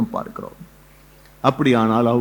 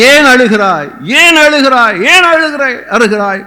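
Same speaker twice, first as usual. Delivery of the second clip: rising impassioned question; 7 semitones higher, 5 dB louder than usual. Acted. Repeated question building.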